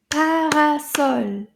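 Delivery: loud, slow "Parasol", syllable by syllable; clapped-out syllables. Shown as a demonstration. Teacher leading children to clap the syllables, slow and emphatic. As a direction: stretch each beat of "Parasol" loudly for clapping. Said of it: "Let's clap them".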